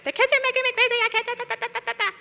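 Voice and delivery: high, whiny tantrum voice; child's temper tantrum. Retold or imitated. Body's voice as a tantrum child, high and whining. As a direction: whine it like a stamping toddler. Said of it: "sounds like a child throwing a temper tantrum".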